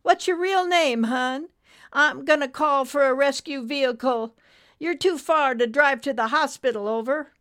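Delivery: high, loud nurse on the CB; urgent radio nurse. Acted. Nurse calls a rescue for the trucker, high and urgent. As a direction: take charge in a high, projected voice.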